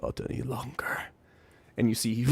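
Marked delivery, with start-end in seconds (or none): 0.0-1.0 low, soft, grim voice; quietly determined; low resolve. Acted. Thord telling Argmith his resolve in a low hushed voice.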